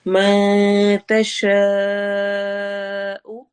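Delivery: very slow chant, long held vowel; Quran recitation. Shown as a demonstration. Teacher chanting slowly for students. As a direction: hold the aa out long. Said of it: "मा में नून".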